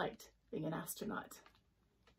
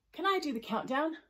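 eager asking; 7 semitones higher, 4 dB louder than usual. A narrator's reading. Jellyfish asks eagerly.

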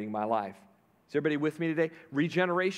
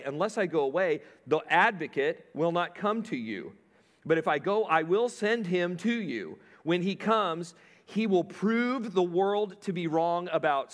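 high, loud, driving sermon voice; preaching at full force; 5 semitones higher, 3 dB louder than usual. Acted. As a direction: preach it to the congregation with fire.

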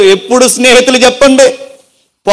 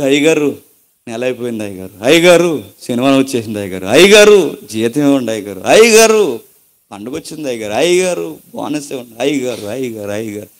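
soft, repeated begging of a servant to his master; a servant softly nagging the master for wages; pleading servant; 10 semitones lower, 6 dB quieter than usual. Retold or imitated. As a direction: plead quietly, over and over, with the boss.

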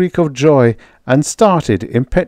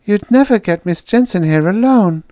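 put-on young woman; 12 semitones higher than usual. The girl objecting.

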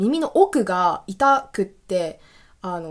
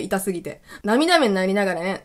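normal talking voice

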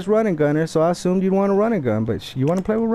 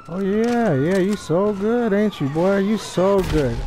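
slow, sarcastic fake praise; taunting the opponent who keeps hiding, slow and sarcastic; sarcastic mock praise; slower than usual. Real, not acted. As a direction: sneer "you so good" slowly at the opponent.

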